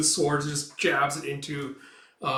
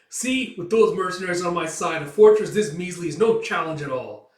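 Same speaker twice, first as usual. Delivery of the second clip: louder, higher gloating; smug boast; 5 semitones higher, 8 dB louder than usual. Acted. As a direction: brag about the easy win. Noble boasting.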